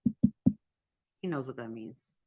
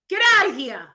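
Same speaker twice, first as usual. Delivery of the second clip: loud, higher, incredulous outburst; outraged; 8 semitones higher, 8 dB louder than usual. Real, not acted. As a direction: blast it in disbelief. Outraged at the chat.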